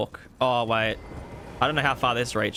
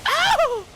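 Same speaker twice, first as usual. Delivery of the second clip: loud high-pitched "Ow!"; real startled yelp; sharp high yelp; 6 dB louder than usual. Real, not acted. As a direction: yelp in sudden pain. Hit in game.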